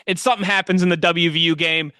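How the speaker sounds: ordinary talk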